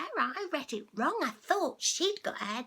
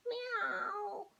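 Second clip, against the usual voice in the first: whispered meow; 8 semitones higher, 7 dB quieter than usual. Acted. Cat meows in story.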